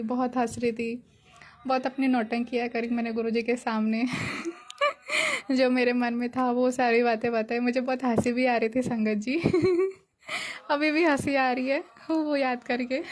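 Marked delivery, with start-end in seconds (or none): 4.1-5.5 giggle. Real, not acted. Laughing while recalling her antics. 9.5-9.9 giggle. Real, not acted. Laughing while recalling her antics.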